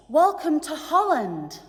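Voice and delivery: high, loud, bright cabin announcement voice; cheerful stewardess on the intercom. Acted. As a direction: chirp it like a flight attendant greeting passengers. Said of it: "the stewardess comes in, in and says".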